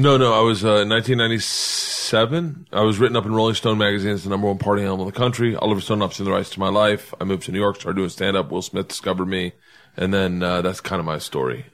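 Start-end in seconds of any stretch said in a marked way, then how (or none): 2.7-9.5 quick, rote rundown tailing softer; rattled off quickly. Real, not acted. Comic giving his often-told backstory to the podcast host, rattled off quickly. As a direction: reel off a well-worn bio fast and low. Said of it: "I like how you did it quickly".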